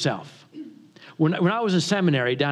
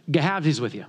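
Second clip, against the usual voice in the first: pure country dialect; 4 semitones lower, faster than usual. Retold or imitated. Country boy to homeowner at the door, thick Southern accent. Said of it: "he's pure country".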